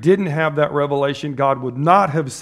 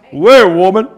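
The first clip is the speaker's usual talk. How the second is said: higher, louder, breaking into laughter; laughing Jesus quote; 6 semitones higher, 8 dB louder than usual. Retold or imitated. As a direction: play Jesus brushing off his mother with a laugh. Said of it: "He goes".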